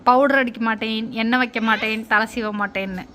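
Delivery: mock the big brother's refusals in a low voice; stubborn boy. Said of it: "அவங்க அண்ணன் அப்படியே வர்றான்".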